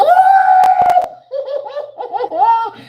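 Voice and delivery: high, loud delighted laughing scream; shrieking laugh. Real, not acted. Thrilled by a viewer's comment, shrieking laugh. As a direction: burst into a squealing laugh.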